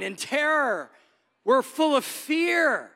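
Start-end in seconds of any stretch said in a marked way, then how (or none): 1.4-2.9 higher, slow and trembling-tinged; mock fearful. Preacher acting out people full of fear, higher and slow. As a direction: play it scared and small. Describing terror.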